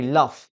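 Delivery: LOVE ending in F; wrong pronunciation. Shown as a demonstration. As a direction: say LOVE as "luff", with an F. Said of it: "No, we never say love".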